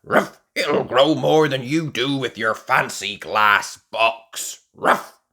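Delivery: loud barking comeback; gruff dog retort. A narrator's reading. Grandad Dog fires back at Grandpa Pig, loud and gruff. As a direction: snap back at the neighbour.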